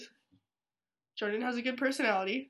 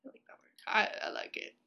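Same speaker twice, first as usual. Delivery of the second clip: whispering; 6 dB quieter than usual. Pretend. Repeating "I like it" softly.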